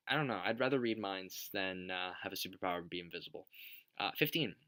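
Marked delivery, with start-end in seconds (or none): none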